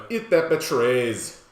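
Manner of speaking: slow, hammed-up Jamaican accent; bad Jamaican movie-villain accent. Shown as a demonstration. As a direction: draw it out in a badly faked Jamaican accent. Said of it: "I say it in a Jamaican accent".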